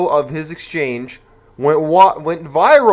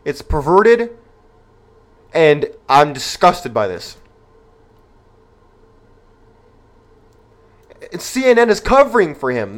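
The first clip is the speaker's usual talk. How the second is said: outraged; 4 dB louder than usual. Real, not acted. Outraged commentary on the video.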